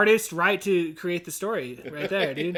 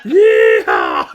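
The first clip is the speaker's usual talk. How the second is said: big drawn-out shouted yeehaw; full-throated rodeo whoop; cowboy yell; 12 dB louder than usual. Acted. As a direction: holler it out long and loud. Echoes the cowboy crash yell.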